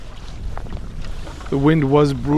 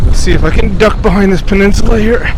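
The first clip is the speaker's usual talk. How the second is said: raised voice against the gusts; shouting over wind; 4 dB louder than usual. Real, not acted. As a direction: call out over a strong headwind. Talking into strong headwind.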